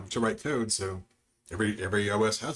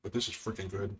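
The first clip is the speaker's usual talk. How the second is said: chewing; 7 dB quieter than usual. Real, not acted. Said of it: "I hope you can't hear my chewing".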